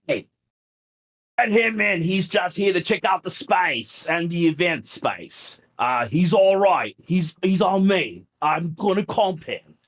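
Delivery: high mock British accent; Neville Wells. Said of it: "in his heavy British accent".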